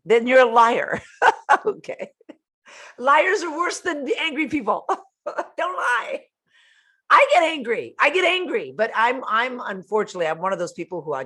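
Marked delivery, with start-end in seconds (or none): 3.0-6.2 high-pitched, laughing tease; amused scolding broken up by laughter. Real, not acted. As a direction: laugh through the words. Laughing while teasing angry people.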